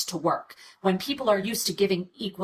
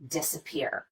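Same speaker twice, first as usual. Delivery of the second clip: "disappear" with lighter stress on dis, main stress on pear; secondary stress; 5 semitones lower, 8 dB quieter than usual. Shown as a demonstration. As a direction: give "disappear" a half-strong first syllable and strongest last. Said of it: "An example of secondary stress would be the first syllable".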